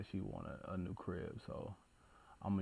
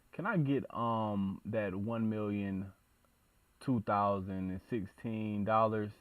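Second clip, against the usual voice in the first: ordinary talk, nothing marked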